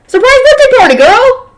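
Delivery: high, loud festive cheer; excited surprise call. Acted. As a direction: shout it out like the party host springing the surprise. Party guests shout surprise.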